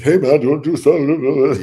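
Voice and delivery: mock eager fan. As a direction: play the pushy fan, overdoing it. Mocking an overeager approach.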